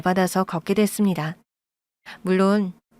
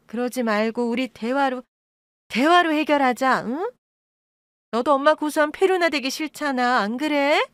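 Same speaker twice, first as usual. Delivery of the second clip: higher, wheedling plea; pleading; 5 semitones higher than usual. Acted. Birth mother begging daughter to settle, higher wheedling tone. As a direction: coax her into agreeing.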